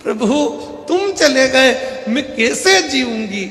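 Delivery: high, hammed-up wail of a grieving wife; wailing widow. Wife wails over her husband's body, hammed-up. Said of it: "पत्नी धाड़ धाड़ के रोने लगी".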